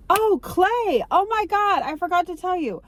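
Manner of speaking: gasp, then high, startled exclamation; real high-pitched surprise at remembering; sudden realization. Real, not acted. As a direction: burst out as it suddenly comes back to you. Suddenly remembers a forgotten detail.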